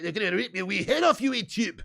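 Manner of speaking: hammed-up Scottish threat, pitch climbing at the end; mock an angry Scot; aggressive Scottish accent. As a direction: caricature of a menacing Glaswegian accent. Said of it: "most Scottish people sound like".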